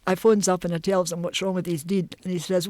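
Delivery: ordinary talk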